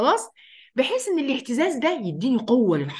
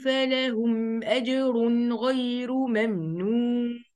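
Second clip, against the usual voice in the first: slow, melodic tajweed chant; Quran recitation; much slower than usual. Acted. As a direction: recite slowly and drawn out like in the mosque.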